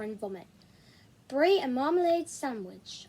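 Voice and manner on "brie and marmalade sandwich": slow, deliberate wish spoken carefully; slow careful order. Acted. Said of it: "I said to myself".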